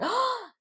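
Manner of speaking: high, happy gasp; delighted gasp. Retold or imitated. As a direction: gasp with joy at seeing him. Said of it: "Sierra's just like".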